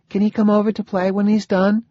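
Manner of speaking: put-on high-pitched small child's voice; little girl. A narrator's reading. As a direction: ask like a curious little girl. Zoe, small child, asks father.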